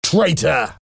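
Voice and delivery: high, accusing exclamation; furious shout. A narrator's reading. Valjean turns on the captured spy, furious shout. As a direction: cry it out high with mild fury. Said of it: "Valjean shouted, furious".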